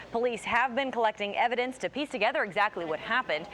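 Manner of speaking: ordinary talk, nothing marked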